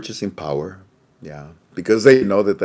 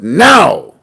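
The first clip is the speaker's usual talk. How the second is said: higher, louder, barked presidential order; commanding shout; 7 semitones higher, 7 dB louder, slower than usual. Retold or imitated. The president ordering the central bank, a higher, louder commanding shout. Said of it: "the president turned to the C B I and said".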